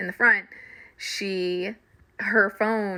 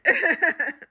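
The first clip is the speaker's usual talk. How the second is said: laughing again; 11 semitones higher, 4 dB louder than usual. Real, not acted. I died laughing.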